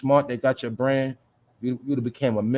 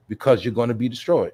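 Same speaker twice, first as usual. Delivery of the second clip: ordinary talk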